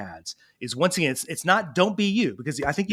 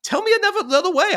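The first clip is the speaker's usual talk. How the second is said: put-on pleading kid; 10 semitones higher than usual. Kid begging dad for another way, high imploring voice. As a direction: voice climbing high in a kid's plea. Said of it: "the kid actually is like".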